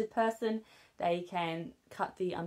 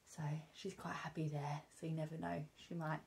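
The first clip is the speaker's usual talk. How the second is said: hushed whisper; 10 dB quieter than usual. Real, not acted. Mum whispers while her baby dozes off, hushed.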